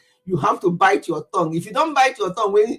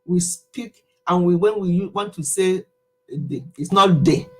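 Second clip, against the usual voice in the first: usual speaking voice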